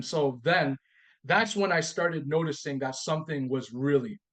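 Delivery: ordinary delivery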